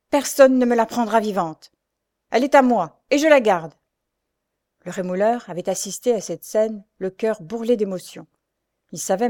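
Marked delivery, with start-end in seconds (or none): 0.1-3.7 louder, higher possessive declaration; raised voice. Acted. Jacques refusing to give up Louise, raised voice. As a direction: raise the voice to claim her. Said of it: "il ajoute en élevant la voix".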